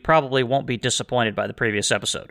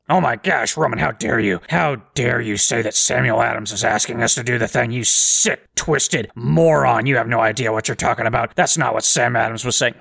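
mock outrage. Imagined listener scolding Roman, in mock outrage. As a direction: play the offended listener, half-mocking.

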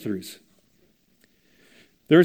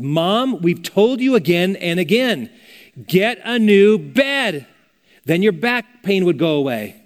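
loud, high exasperated plea to mom; upset, exasperated kids; 5 semitones higher, 6 dB louder, slower than usual. Retold or imitated. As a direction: scold mom harshly, leaning slow on the demand. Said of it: "kids were really getting upset".

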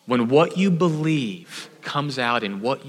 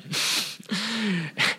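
real laugh; 7 semitones higher, 7 dB quieter than usual. Real, not acted. Laughing at his own story.

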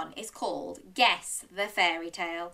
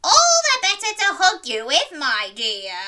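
loud, high wolfish growl; 9 semitones higher, 9 dB louder than usual. Acted. Wolf answering Little Red as Granny, loud growling voice. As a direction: growl it loudly as the disguised wolf. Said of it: "growled the wolf".

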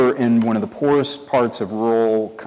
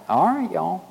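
Tennessee country accent; 6 semitones higher, 4 dB quieter than usual. Shown as a demonstration. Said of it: "my accent kinda changes".

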